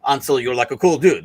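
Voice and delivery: ordinary delivery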